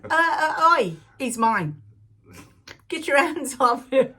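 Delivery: loud, mock-possessive warning; hammed-up territorial wife staking a claim; mock jealous wife. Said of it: "I looked over and I went".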